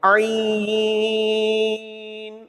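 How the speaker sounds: عین held very long and slow from the throat, half sung; long drawn Ain. Shown as a demonstration. Teacher demonstrating Ain to students, drawn out from the throat. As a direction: stretch عین five alifs from the throat. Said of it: "پانچ الف بھی کھینچیں گے اور حلق سے".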